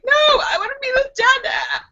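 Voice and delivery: put-on very high whiny toddler voice; baby whine. Baby wanting her real dad, high whiny voice. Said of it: "she's like".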